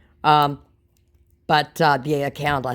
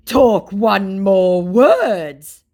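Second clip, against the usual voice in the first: loud, very slow, higher threat; mock threat; 6 semitones higher, 11 dB louder, much slower than usual. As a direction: threaten like a hammed-up scammer. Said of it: "he threatens me".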